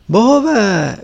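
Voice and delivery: mother's overjoyed exclamation; joyful exclamation. A narrator's reading. Venerable mother spots her returning son at the door, joyful exclamation. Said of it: "vykřikla".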